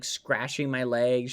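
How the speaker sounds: normal talking voice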